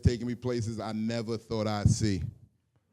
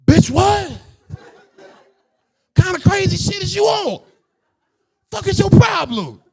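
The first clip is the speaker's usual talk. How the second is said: high, loud incredulous yell; outraged shouting; 12 dB louder than usual. Acted. Comic acts out his shocked reaction, outraged shouting.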